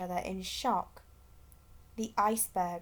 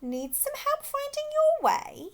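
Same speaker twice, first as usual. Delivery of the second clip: airy high whisper of a bird; snow petrel; 6 dB louder than usual. A narrator's reading. Snow petrel offering the lost penguins help, high airy whisper.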